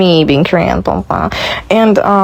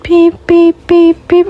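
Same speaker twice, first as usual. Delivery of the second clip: high, louder beep beep mimicry; imitated machine beeping; 11 semitones higher, 4 dB louder, slower than usual. Mimicking the patient's machine across the room, high loud beeps. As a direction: chirp the beeps like a hospital monitor. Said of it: "He's like".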